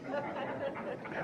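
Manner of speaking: a quick airy chuckle; soft laugh. Real, not acted. Speaker laughing at his own joke about school. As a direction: let a breathy laugh slip out.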